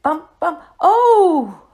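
sudden high loud cry of surprise; startled oh. A narrator's reading. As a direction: yelp as if slipping. Slips and falls down hill.

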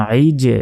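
আইজে with the final j pushed out into an echo; qalqalah bounce. Shown as a demonstration. Teacher shows students qalqalah, আইজে bouncing off the j. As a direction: release the j of আইজে with a small bounce. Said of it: "শেষে একটা ধাক্কা হচ্ছে".

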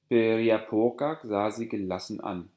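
usual speaking voice

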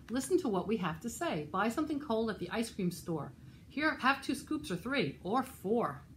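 put-on lively, rhythmic read-aloud for children; animated storytime reading; picture-book narration. A narrator's reading. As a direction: read a rhyming story to little listeners.

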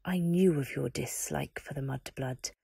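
dark lord scolding a servant; menacing Voldemort. Acted. Voldemort threatens the Warden.

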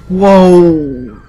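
loud sudden shout of shock; startled whoa. Real, not acted. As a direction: jolt at the crash. Crash surprise.

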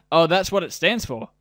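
ordinary talk, nothing marked

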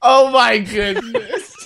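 slow laughing groan. Real, not acted. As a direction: laugh through the words. Laughing at the joke.

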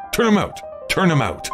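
high-pitched, insistent order; irritated command. A narrator's reading. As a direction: repeat the order impatiently. Todd orders the dog out.

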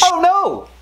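lightly done presidential impression, pitched high; Barack Obama. Dad joke about a dropped shell, high exclaimed impression. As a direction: cry out in dismay as a hammy politician. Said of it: "That's my Barack Obama impersonation".

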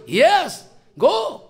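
high-pitched, emphatic go-ahead; retold insistent go-ahead; emphatic permission. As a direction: grant it emphatically, pitch jumping up. Acts out his emphatic permission.

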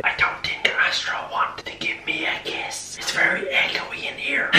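hushed whisper. Real, not acted. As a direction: whisper it close. Whispering in echoey room.

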